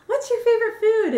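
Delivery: put-on high, eager child's question; little children. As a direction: ask it like a curious little kid. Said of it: "little children where they're like".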